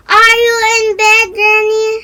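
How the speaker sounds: put-on a girl calling to her grandmother from the door. A narrator's reading. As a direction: sing it out across the house. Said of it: "she called out".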